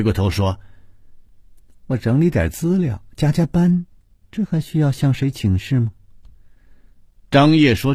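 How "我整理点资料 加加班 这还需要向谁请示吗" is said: high-pitched, dismissive reply; disdainful. A narrator's reading. Colleague tossing back an answer from the corridor, high and disdainful. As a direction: brush off the question with disdain. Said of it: "脸上有一种不屑的表情".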